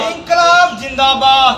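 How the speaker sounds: called-out rally slogan; protest slogan call. Real, not acted. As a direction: lead the crowd's chant. Leader calls out the slogan.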